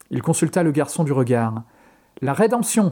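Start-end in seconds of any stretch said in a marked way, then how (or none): 2.2-2.9 higher, gleeful exclamation; mock-solemn triumphant cry; exclaiming. A narrator's reading. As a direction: toss it out like a punchline. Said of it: "s'exclama Tobin".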